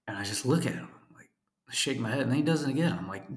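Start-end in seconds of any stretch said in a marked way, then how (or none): none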